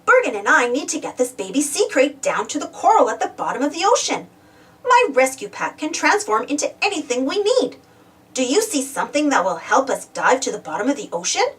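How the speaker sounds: ordinary delivery, nothing marked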